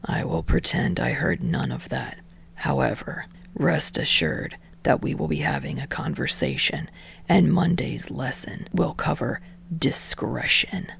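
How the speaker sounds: low whispered teacher menace; dark blunt voice. Acted. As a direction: speak flat and deadly quiet. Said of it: "blunt kitchen knife voice".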